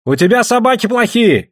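high-pitched challenge; high, heated jeer; shouted taunt. A narrator's reading. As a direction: call out an insult to a rival. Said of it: "крикнул ему Айдамба".

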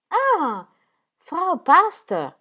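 high, loud, drawn-out surprised greeting; exclaiming. A narrator's reading. Doctor spotting the pastor's wife behind the door, surprised exclaim. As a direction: exclaim on spotting her in the corner. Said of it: "esclamò".

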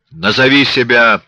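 harsh loud bark; barked order. A narrator's reading. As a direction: snap the order at her. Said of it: "рявкнул светловолосый".

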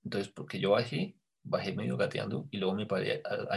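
ordinary delivery

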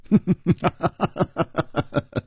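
long, high staccato laugh; hearty laugh. Acted. Chu Liuxiang jokes about withstanding hundreds of moves, laughing. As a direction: laugh it out in a steady run of ha-ha. Hero laughs mid-duel after joking.

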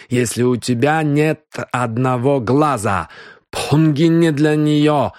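put-on slow, high Indian-accented speech; agitated Indian-accented slow insistence. Indian suspect in broken Russian.

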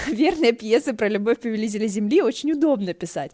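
loud laughing tease to his friend. Acted. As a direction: laugh out loud while teasing. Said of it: "хохотал уже в полный голос".

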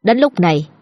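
usual speaking voice

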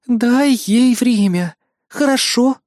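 high, drawn-out coaxing request; gentle pleading. A narrator's reading. Kayli pleads for patience.

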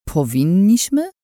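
slow, rising doubtful question; skeptical question. Acted. Kichun questioning Lin with a slow doubtful rise. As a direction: raise an eyebrow with the voice. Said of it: "unosząc brew".

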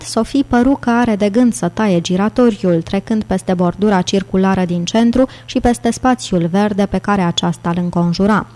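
usual speaking voice

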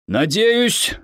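a fussy, hopeful father; father's voice. A narrator's reading. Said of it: "произнёс отец". (голос отца, чуть выше)

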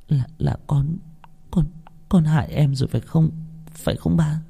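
soft stammering question; timid, halting. A narrator's reading. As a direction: barely dare to ask. Con rụt rè hỏi cha. (rụt rè, ấp úng)

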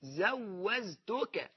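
drawn-out زوزتك with two z sounds; j pronounced as z. Shown as a demonstration. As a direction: stretch زوزتك very slowly, z replacing the j. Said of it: "حتى مش جايب الجيم". (نطق الجيم زايًا في زوزتك، مع مط شديد)

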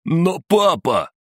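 very high, drawn-out protest; slow high-pitched childish plea; whining son. A narrator's reading. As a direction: whine it slowly like a scolded child. Scolded son whines to father.

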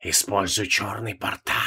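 a hissing voice from inside the staff. Acted. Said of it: "раздался шипящий голос из посоха".

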